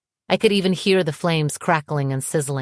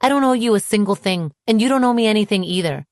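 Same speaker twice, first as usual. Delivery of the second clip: heated, higher, bitter retort; 5 semitones higher, 4 dB louder, faster than usual. A narrator's reading. Woman telling her ex they owe nothing, bitter. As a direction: reject him bitterly, voice raised. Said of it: "I laughed bitterly".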